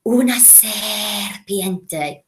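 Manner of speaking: slow, hushed snake hiss; talking to snakes. Shown as a demonstration. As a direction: whisper it like Parseltongue. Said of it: "like you're talking to snakes".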